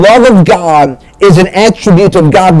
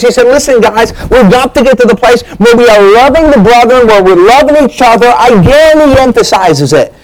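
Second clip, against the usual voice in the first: higher-pitched, driving sermon delivery; urgent preaching; 5 semitones higher than usual. Real, not acted. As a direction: press the point home to the congregation.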